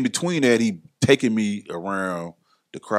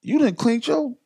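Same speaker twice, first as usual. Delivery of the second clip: much higher, admiring quote; impressed praise; 13 semitones higher than usual. Retold or imitated. Johnny telling his friend he cleaned up his image, high and impressed. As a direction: sound genuinely impressed.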